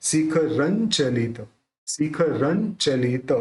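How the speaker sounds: slow "शिखरं चलित" with the बिंदी said as n, "शिखरन् चलित"; teaching the nasal as n, slowly; careful pronunciation. Shown as a demonstration. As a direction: say "शिखरं" slowly, ending in n before च. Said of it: "उच्चारण न करेंगे।".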